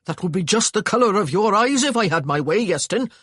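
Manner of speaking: loud, high Welsh scolding; furious tirade at his brother; angry brother. A narrator's reading. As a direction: tear into him for the scare. Angry brother scolding injured boy.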